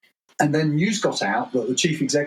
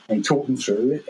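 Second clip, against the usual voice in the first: normal talking voice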